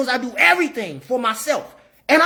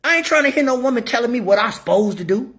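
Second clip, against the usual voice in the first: ordinary talk, nothing marked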